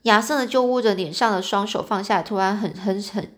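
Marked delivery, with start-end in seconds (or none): none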